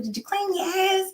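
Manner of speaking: mock yell. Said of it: "what I would have to yell".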